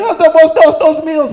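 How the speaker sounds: high, loud wailing plea; imitating a crying drunk begging; mock pleading. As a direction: beg through fake tears. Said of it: "The Mexican guy's pleading like".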